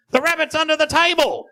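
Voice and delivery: high parrot voice giving the trick away. Acted. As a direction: squawk out the secret as the parrot.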